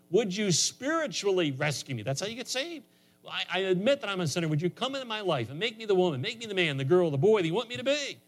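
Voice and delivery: high-pitched, impassioned pulpit delivery; fervent preaching. Real, not acted. Preacher tells congregation how you get saved, fervent and high-pitched. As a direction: preach it with rising urgency, pitched well up. Preaches salvation and models prayer.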